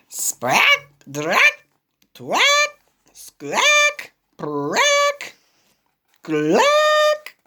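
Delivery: high squawking toots; string of trumpet blasts. Acted. Elephant playing a tune, high squawking toots. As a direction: squawk out each toot.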